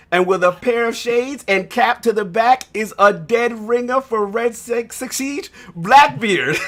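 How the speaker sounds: loud, slow, rising hype intro; hype announcer intro. Acted. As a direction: build him up like a ring announcer.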